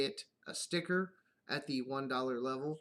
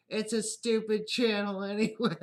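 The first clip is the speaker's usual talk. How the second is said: higher, louder mocking kid voice; 7 semitones higher, 7 dB louder than usual. Kids brushing off dad's channel, higher mocking voice.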